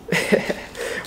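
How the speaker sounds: quiet breathy chuckle; a little private laugh; soft laugh. Real, not acted. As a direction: laugh to yourself. Laughing at own reading.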